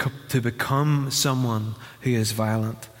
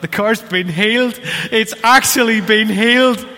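higher and much louder, overjoyed; joyful exclaim; 8 semitones higher, 7 dB louder than usual. Retold or imitated. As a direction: proclaim a miracle in delight. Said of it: "And I thought".

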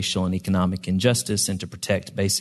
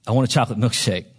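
quick, pitched-up eager plea; eager interruption; 4 semitones higher, 3 dB louder, faster than usual. A narrator's reading. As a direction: blurt it out like an impatient kid. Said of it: "Jenkins interrupted immediately".

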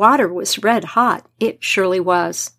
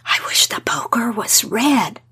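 whispered to herself; whispered thought. Acted. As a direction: breathe it out under your breath. Mother's private thought.

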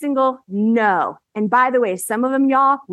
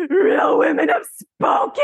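tearful exclamation; 7 semitones higher, slower than usual. Retold or imitated. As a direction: cry it out in disbelieving triumph. Emotional outburst after sell-out.

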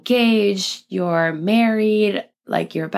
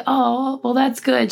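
higher, bright surprise; pleasantly surprised; 7 semitones higher, slower than usual. Retold or imitated. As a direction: caught off guard by a nice compliment. Touched mom answering a compliment.

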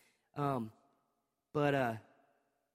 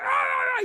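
much higher, loud broken wails; imitated sobbing wail; 7 dB louder than usual. Dad acting out his son's sobbing at the shower door, high and loud. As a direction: sob it out in bursts like a hurt child. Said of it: "And he's like".

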